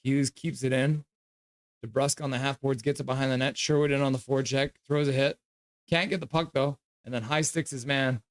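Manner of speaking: live game commentary over a stream; play-by-play. Acted. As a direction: call the action like a broadcaster.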